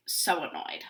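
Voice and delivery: ordinary delivery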